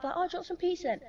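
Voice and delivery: high, sweet parent offer. Retold or imitated. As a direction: coax the kid kindly. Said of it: "if you be like".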